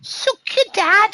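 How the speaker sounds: very high, loud, drawn-out cartoon voice; high silly mimicry. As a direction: parrot it in a slow, loud squeak.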